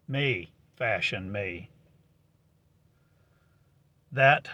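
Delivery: ordinary talk, nothing marked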